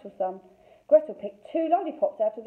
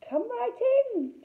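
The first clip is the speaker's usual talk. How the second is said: high, slow sugary coo; a sweet high coo hiding a witch. A narrator's reading. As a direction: lure them in sweetly. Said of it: "she cooed".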